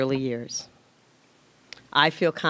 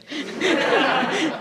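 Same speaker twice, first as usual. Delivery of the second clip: a high teary laugh breaking through crying; laughing at herself while crying; tearful laugh; 11 semitones higher than usual. Real, not acted. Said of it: "I'm not crying".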